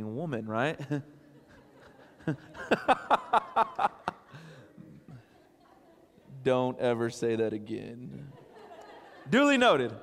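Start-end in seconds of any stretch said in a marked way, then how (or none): 2.2-5.2 high, bursting laughter; big laugh. Real, not acted. Preacher laughing with congregation at his joke, real laugh. 9.3-9.9 high, loud reply through laughter; laughing concession. Real, not acted. Preacher conceding after his joke, loud and laughing.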